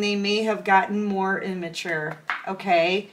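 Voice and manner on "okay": usual speaking voice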